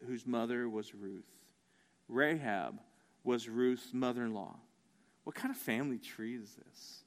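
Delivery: low, soft reflective delivery with long pauses; hushed preaching. Real, not acted. Preacher marvels at Rahab in Jesus' lineage, hushed. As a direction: let each revelation land quietly.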